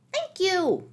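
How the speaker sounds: high, loud leprechaun thanks; relieved little man thanking his rescuer; cried. A narrator's reading. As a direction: cry out thanks in a squeaky voice. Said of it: "cried the leprechaun".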